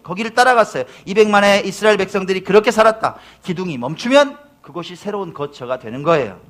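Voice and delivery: high, loud pulpit storytelling; full-force preaching. Acted. 광야의 기둥 이야기를 회중에게 외치며, 힘찬 설교. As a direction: paint the wilderness vividly.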